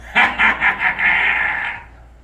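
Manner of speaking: long, loud cackling "ha ha ha"; loud staccato laughter. Acted. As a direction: burst into a drawn-out laugh. Laughs after sting hits the shell.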